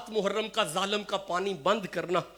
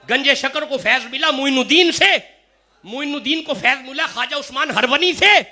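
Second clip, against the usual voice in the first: raised, ringing preacher's voice over the chain; preaching at full force; 8 semitones higher, 7 dB louder than usual. Acted. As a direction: proclaim each link louder and higher. Chain of saints preached loudly. (جوش سے بلند آواز میں وعظ)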